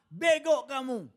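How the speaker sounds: loud, slow scolding; scolding the driver. Retold or imitated. Master scolding his driver, loud and slow. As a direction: bark it at the careless driver. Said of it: "Sopirnya salah".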